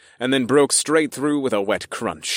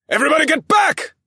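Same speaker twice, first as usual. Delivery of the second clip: high, loud, fast shout; shouted order; 6 dB louder, faster than usual. A narrator's reading. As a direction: yell the warning to everyone. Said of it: "I ordered my crew".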